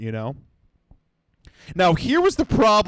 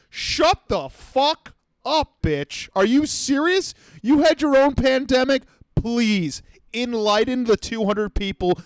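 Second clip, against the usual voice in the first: mock outraged rant; 9 semitones higher than usual. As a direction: blow up at the speaker, pitched way up. Ranting at the beach speaker.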